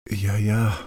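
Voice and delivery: ordinary delivery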